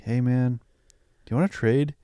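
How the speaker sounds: normal talking voice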